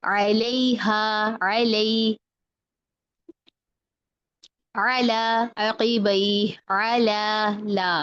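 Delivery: slow drawn-out अला with a light L; spread thin lam. Shown as a demonstration. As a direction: recite अलैहा and अला, holding a thin L. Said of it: "ये मोटा नहीं करना".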